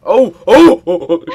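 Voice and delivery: high, loud alarmed oh's; panicked cries. Real, not acted. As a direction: cry out in alarm. Panic in game.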